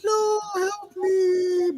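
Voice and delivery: very high, slow drawn-out cry for help; crying plea. Retold or imitated. Witch sinking in quicksand cries for help. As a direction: wail it like she's sinking. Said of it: "She's like".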